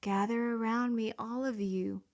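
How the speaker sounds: normal talking voice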